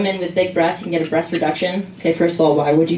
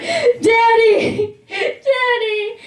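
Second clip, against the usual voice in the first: put-on much higher, crying child's shout; child crying for dad; 13 semitones higher, much slower than usual. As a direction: wail for daddy like a scared kid. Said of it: "I'm screaming bloody murder".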